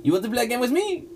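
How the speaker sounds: playful. Acted. Character offers a game.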